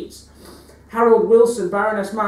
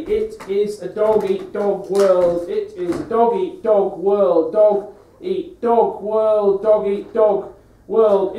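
slow emphatic refrain; 5 dB louder, much slower than usual. Acted. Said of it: "slow repeated catchphrase".

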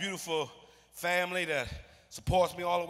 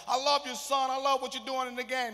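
lightly voiced doting stepfather, a bit higher; a hint of a fond stepdad; imitating stepfather; 6 semitones higher than usual. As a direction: gush like a proud dad. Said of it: "That's how he talk".

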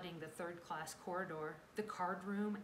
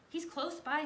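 higher, louder, eager voice; excited report; 9 semitones higher, 6 dB louder than usual. A narrator's reading. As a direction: call it out eagerly. Annie hears the dog nearby.

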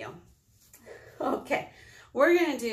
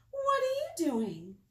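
high sheep voice; slower than usual. Acted. Sheep character question.

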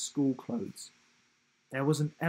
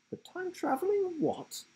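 much higher, puzzled; baffled question. A narrator's reading. Will baffled by the time-travelling elephant, high and puzzled. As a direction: ask it in total confusion.

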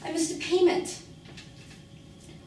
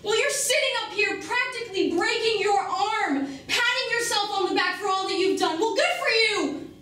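raised, higher-pitched bitter tirade; angry outburst; 5 semitones higher, 3 dB louder than usual. Acted. Woman confronting Mikey about having no choices, angry outburst. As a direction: let frustration boil over, loud and high.

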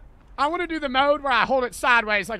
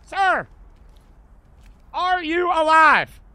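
loud slow call; 5 semitones higher, 7 dB louder, much slower than usual. Pretend. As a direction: call out slowly to him. Calling to downed civilian.

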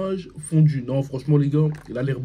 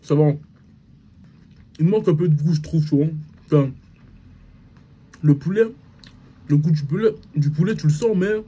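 chewing while talking; talking between bites of burger; mouth full. Real, not acted. Seen 1: Bites at -5; pursed, full cheeks chewing through the labelled words.

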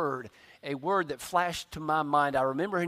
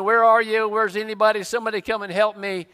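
raised, higher-pitched mock cry for help; retold yelling; 4 semitones higher, 7 dB louder, faster than usual. Lost son calling for anybody to help, acted yell. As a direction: call out urgently, re-enacting being lost. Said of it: "I'm yelling and I'm screaming".

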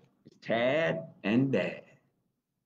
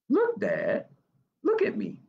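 little frog calling. Acted. Tad calls to dad.

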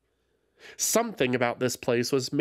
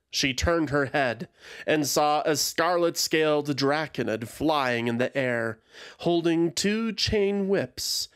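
ordinary delivery, nothing marked

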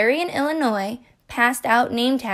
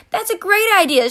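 much higher, louder excited shriek; 9 semitones higher, 5 dB louder, faster than usual. Acted. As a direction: squeal with delight. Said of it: "shrieked Nebraska".

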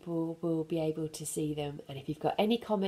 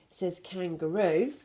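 ordinary delivery, nothing marked

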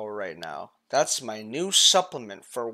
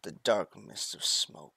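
dramatic narration; 5 semitones lower, 8 dB quieter than usual. Pretend. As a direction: narrate the end of the world gravely. Mock dramatic doomsday narration.

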